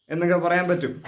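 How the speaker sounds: usual speaking voice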